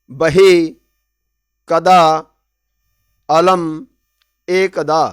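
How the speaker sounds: each word called out loud and separate for the class to repeat; clear recitation. Shown as a demonstration. Teacher models each word loudly for students to repeat. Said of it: "जैसे मैं बोल रहा हूँ आप वैसे भी".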